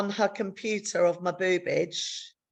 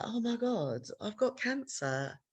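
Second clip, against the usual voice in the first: softer, slightly higher inner voice; dawning disbelief; 3 semitones higher, 7 dB quieter than usual. Retold or imitated. Walking home alone after diagnosis, hushed disbelief. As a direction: say it to yourself, taking it in.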